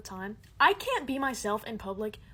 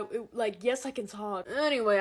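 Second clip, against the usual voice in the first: usual speaking voice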